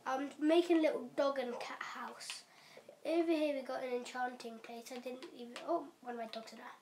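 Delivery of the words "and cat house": whispering. Real, not acted. Whispered aside during tour.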